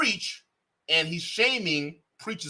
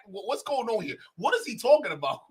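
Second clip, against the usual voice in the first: normal talking voice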